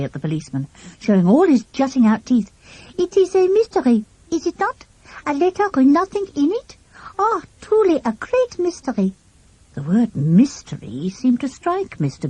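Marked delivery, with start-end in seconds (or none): 3.0-9.1 put-on French accent. A narrator's reading. French boy teasing policeman.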